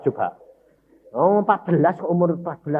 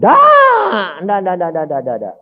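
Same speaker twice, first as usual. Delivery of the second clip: fading no no no; 4 semitones higher, 7 dB louder, slower than usual. Acted. Emphatic denial, fading.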